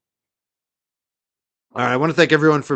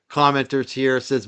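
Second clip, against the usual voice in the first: usual speaking voice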